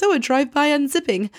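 high, light mock jokiness; making light of it. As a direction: imitate someone brushing it off as a joke. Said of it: "tries to make light of it".

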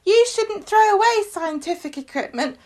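put-on high-pitched, earnest child voice; little Sam. Child lectures the man about his telescope, high and earnest. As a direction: lecture like a squeaky little know-all. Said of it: "He goes".

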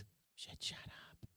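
breathless hissed whisper; whisper yelling. Acted. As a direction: whisper it like a warning. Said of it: "Why are you whispering?".

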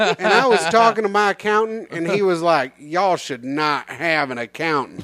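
put-on Madea-style Southern accent, telling a joke. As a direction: lay on the Southern accent for the punchline.

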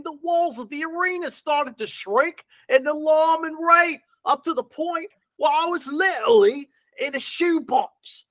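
bad Mary Poppins Cockney accent, yelling.

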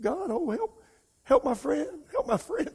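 high, tearful cry for help; weeping prayer. Retold or imitated. Preacher reliving praying in tears for his friend, high weeping plea. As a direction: cry out to God for a friend, voice breaking. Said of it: "wept, said".

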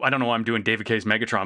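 normal talking voice

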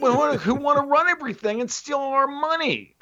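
high, put-on everyman voice; mock British commoner. Answering his mock bigot with a high, put-on common-folk voice. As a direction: play a sarcastic ordinary Brit.

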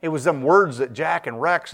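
normal talking voice

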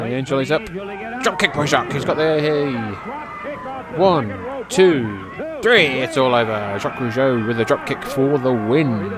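loud rising call of the finish; excited play-by-play. Real, not acted. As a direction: call the three-count loud. Commentator calls the pinfall count.

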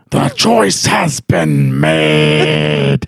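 very slow, deep booming proclamation; goddess voice. Retold or imitated. The goddess declaring the choice made, ominous. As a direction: intone it low, every word stretched.

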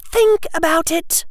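put-on very high, irritated girl; snapped. Hermione to Harry about her choice, snapping. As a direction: snap it in a high-pitched voice. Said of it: "Hermione snapped".

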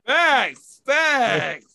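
high, loud "facts"; emphatic agreement. Real, not acted. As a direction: hype up the point.

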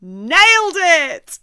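slow loud cheer; excited. Real, not acted. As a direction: celebrate the take out loud. Celebrating a take.

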